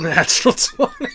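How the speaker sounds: high, loud, through laughter; laughing announcement. Real, not acted. Player rolls a natural twenty for insight, laughing. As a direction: call it out while really laughing.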